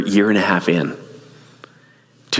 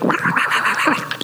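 rapid squabbling duck noise; mimicked ballistic quacking; imitated duck fight. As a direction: rattle out a flurry of fighting ducks. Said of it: "They're just like".

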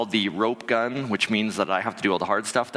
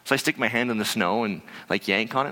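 ordinary delivery

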